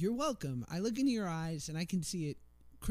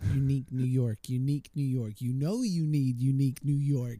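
louder, crisp "Unique New York" drilled; tongue twister warm-up; 4 semitones lower, 4 dB louder than usual. Shown as a demonstration. Asked to try it, he performs the twister louder. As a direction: clear throat and punch out the twister. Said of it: "let's hear it".

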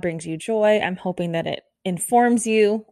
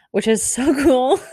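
laughing delight; 6 semitones higher, 4 dB louder than usual. Real, not acted. Excited about upcoming guests.

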